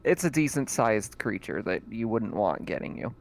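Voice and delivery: ordinary delivery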